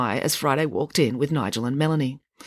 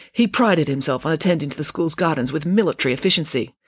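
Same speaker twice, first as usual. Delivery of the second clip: usual speaking voice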